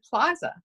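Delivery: "plaza" with an open AH, "plah-za". Shown as a demonstration. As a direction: say "plaza" with the A of "father". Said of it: "an open A".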